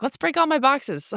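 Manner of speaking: high-pitched, jokey gung-ho cheer; mock eagerness. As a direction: pretend to be thrilled, about to laugh. Mock eagerness, laughing at herself.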